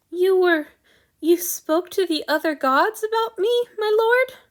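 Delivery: choked, high and loud on the verge of tears; forcing the words past a lump in the throat; strangled words. A narrator's reading. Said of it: "choke out some strangled words".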